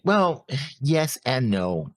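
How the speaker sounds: ordinary talk, nothing marked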